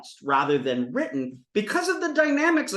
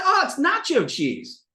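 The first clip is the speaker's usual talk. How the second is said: joke character; 9 semitones higher than usual. Acted. Acting out cheese eater in joke.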